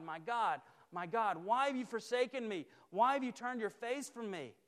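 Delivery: a touch of an anguished cry; mildly voiced plea to God, lifted; Jesus crying out. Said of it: "he cried out to God".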